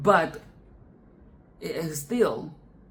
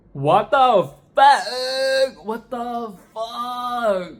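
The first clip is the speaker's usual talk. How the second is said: disgusted; 5 semitones higher, 4 dB louder, much slower than usual. Pretend. Disgusted reaction as Kiki.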